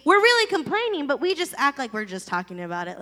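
normal talking voice

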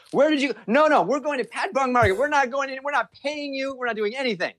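high, indignant complaint; wife fuming that the driver cheated them; mock angry wife. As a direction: imitate the angry wife accusing.

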